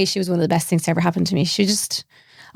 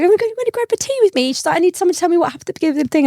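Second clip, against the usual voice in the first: eager invitation; 9 semitones higher, much faster than usual. Retold or imitated. Beth asking her for tea.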